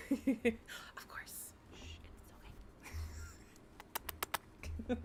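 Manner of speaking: breathy shushing whisper; jokey soothing whisper; mock ASMR whisper. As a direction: hush them playfully. Repeats joking ASMR whisper.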